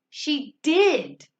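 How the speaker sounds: exaggerated, teacherly emphasis on did; stress on did. Shown as a demonstration. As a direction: land hard on did and hold it. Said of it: "You want to exaggerate".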